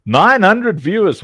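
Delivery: slow, loud announcement; excited. Real, not acted. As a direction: marvel at the number. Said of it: "What are you people doing?".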